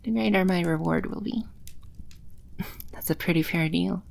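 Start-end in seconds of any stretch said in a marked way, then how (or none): 1.5-2.9 a quiet, airy demon laugh; soft breathy chuckle. Acted. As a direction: chuckle under your breath, amused.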